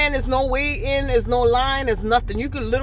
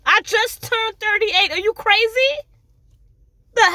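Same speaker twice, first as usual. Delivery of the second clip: high, loud outrage at the age joke; outraged; 10 semitones higher, 4 dB louder than usual. Real, not acted. Husband cut her age in half, loud outraged squawk. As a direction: yell it like she insulted you. Outraged at being called older.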